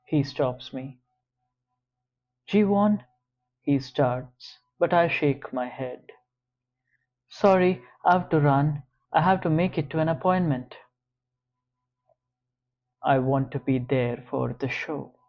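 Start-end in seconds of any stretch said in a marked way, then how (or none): 2.5-3.0 raised, urgent call. Acted. George stops her, calling out. 7.3-10.8 quick, brushing-off apology; hurried excuse. Acted. Brushing him off, rushing away.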